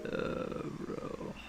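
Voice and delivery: breathy whispered uh, bro; whispered exasperation. Real, not acted. Frustrated coder giving up on the bug, whispered aside. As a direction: let it out under your breath, defeated.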